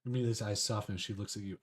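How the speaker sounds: ordinary talk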